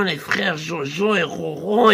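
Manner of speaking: ordinary talk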